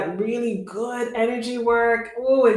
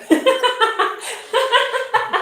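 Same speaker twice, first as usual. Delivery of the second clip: bright burst of laughter; high laugh; 4 dB louder than usual. Real, not acted. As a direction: laugh out loud, high and free. Laughs after a joke.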